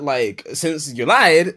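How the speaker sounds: ordinary talk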